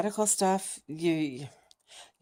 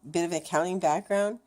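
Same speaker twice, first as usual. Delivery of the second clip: normal talking voice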